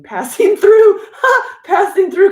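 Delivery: high, loud burst of laughter through the words; laughing. Real, not acted. Greeting a viewer who is passing through, laughing. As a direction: crack up while saying it.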